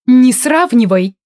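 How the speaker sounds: loud, slow explosive snap; angry outburst. Acted. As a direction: burst out in outrage. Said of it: "взорвалась графиня".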